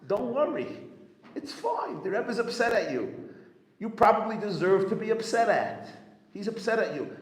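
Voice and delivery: high, loud and urgent, pressing the point home; emphatic reassurance. Retold or imitated. Rabbi Yoel urging the upset chassid, high loud reassurance. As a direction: reassure him forcefully, almost pleading.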